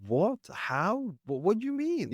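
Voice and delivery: high, rising mock confusion; baffled newcomer. Newcomer baffled by Northern accents, high and confused. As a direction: act utterly lost by the accent. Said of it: "it was like".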